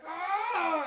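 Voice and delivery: high squeal of disgust; mock yelp. Ripping the crab claw, a high squeal. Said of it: "And I was like".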